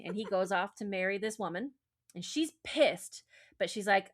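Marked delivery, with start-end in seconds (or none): none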